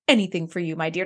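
low, soft romantic reply; tender Moriarty. Retold or imitated. Podcaster voicing Moriarty answering the Countess, low and tender. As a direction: croon it low to your love. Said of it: "And he's like".

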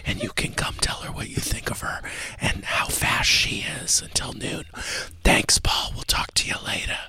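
hushed radio host whisper; breathy secretive whisper on air. Acted. Host whispers all show.

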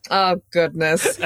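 normal talking voice

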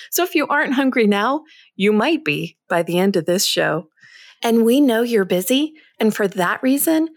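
upbeat, projected host intro; energetic scripted show opener; podcast intro read. Acted. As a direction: read the episode teaser brightly, with punched key words.

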